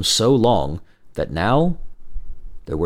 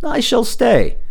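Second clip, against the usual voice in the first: a lightly voiced resolute hero; firm and brave, a touch higher; young man; 13 semitones higher, 4 dB louder, slower than usual. A narrator's reading. Hero promises the princess he stays, resolute.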